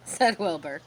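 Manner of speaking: ordinary delivery, nothing marked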